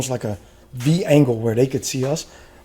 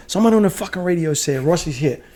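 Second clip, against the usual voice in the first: retold radio rant, pitched up; scolding, re-enacted with rising heat; going off; 5 semitones higher, 3 dB louder than usual. Telling Rusty to clear the radio, going off. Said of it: "I'm going off".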